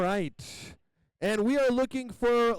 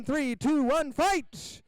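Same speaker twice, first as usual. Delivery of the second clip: fight countdown shout; 7 semitones higher, much slower than usual. Real, not acted. Announcer to the arena crowd, starting the fight, rising shout. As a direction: count down, then shout fight much higher.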